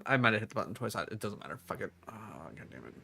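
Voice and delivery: soft, muttered curses; frustrated muttering. Real, not acted. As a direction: grumble it under your breath. Muttering in frustration after dying.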